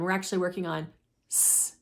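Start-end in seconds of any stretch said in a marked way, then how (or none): 1.3-1.8 "s" as a long hissing air leak; flat tire sound. Shown as a demonstration. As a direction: let "s" hiss like a tire. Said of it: "this new flat tire sound".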